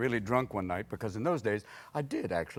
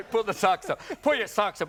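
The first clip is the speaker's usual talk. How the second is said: lightly voiced nagging old man; a hint of a kvetching grandpa; 7 semitones higher than usual. Mocking the host's sock request as a lightly voiced old man.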